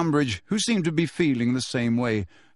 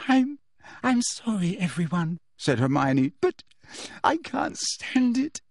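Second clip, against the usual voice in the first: faking tears; fake sobbing; 5 semitones higher than usual.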